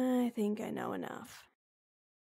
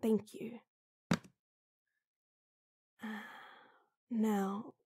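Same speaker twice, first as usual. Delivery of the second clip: ordinary delivery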